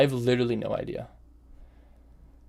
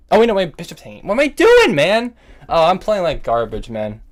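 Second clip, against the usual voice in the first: high, loud alarmed outburst; real panicked exasperation; 6 semitones higher, 7 dB louder than usual. Real, not acted. Chess player spotting hanging bishop, panicked exasperation. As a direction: cry out in dismay at your own blunder.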